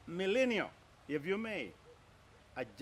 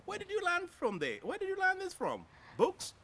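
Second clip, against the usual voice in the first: asked much higher, in wide-eyed wonder; amazed question; 12 semitones higher than usual. Real, not acted. Host amazed, asking the young guest where she learned this, much higher pitched. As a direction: marvel at her, pitch lifted high.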